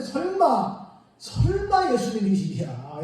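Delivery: drawn-out, incredulous "surely not"; acted disbelief. Mary doubting the voice is Jesus, slow acted disbelief. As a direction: stretch it slowly in mock doubt.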